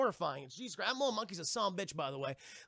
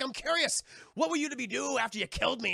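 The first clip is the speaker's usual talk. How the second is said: higher, louder taunting pirate voice; mock pirate captain; 7 semitones higher, 4 dB louder than usual. Stabbed pirate questions his attacker, mock taunt. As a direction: play the unbothered villain, raised and theatrical.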